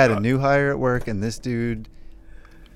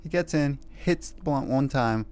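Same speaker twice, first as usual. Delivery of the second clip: ordinary delivery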